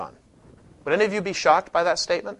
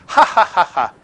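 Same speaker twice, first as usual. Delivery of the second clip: loud, deliberate "ha ha ha ha"; mock laugh; 3 semitones higher, 8 dB louder than usual. Shown as a demonstration. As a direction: demonstrate a laugh as a word anyone would understand. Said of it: "So if I say".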